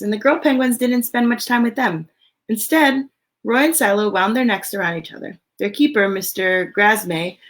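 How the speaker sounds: lively, expressive storybook read; reading to children. Acted. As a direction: read it out to a room of little kids. Reads picture book aloud.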